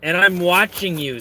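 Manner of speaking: high, deliberate mock threat; Dandy. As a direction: read it out as a theatrical menace. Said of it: "says Dandy to Kevin".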